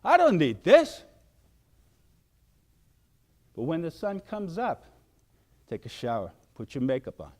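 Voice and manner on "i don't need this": loud, higher fed-up huff; fed-up attitude. Retold or imitated. Fed-up workers with attitudes, loud huffy line. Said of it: "their attitudes".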